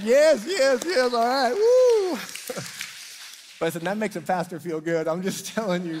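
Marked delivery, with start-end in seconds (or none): 0.0-2.2 loud high cheering yes yes; real loud cheer ending in a high woo; celebrating. Real, not acted. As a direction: whoop it up like you won. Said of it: "I'm gonna go head to head".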